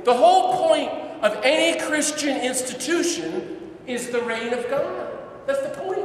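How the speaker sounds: loud, high preaching of the point; fired-up preacher's cry; impassioned declaration. Retold or imitated. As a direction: proclaim it to the whole room. Preacher voices Ellacuría's declaration.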